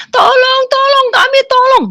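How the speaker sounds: high, loud desperate cry of the crowd; panicked high cry for help. Retold or imitated. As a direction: plead for rescue at the top of the voice. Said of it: "semua manusia teriak-teriak minta tolong".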